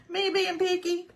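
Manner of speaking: laughing repeat. Real, not acted. Host laughing at her own fussiness to viewers, high and giggly. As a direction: tease herself through a laugh.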